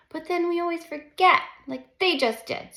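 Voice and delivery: a hint of a wise older brother; lightly voiced knowing older boy; insightful big brother. A narrator's reading. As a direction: point it out knowingly. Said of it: "states our man in an insightful way".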